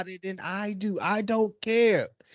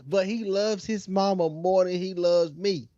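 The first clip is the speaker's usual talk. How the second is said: ordinary talk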